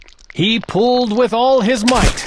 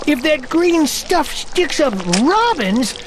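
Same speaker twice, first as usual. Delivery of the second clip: put-on high, slow, fretful old man; wringing-hands worrier, drawn out; worried bell ringer; 7 semitones higher, slower than usual.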